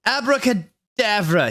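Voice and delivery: high, slow spell cast; yelled spell. Acted. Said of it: "he yelled".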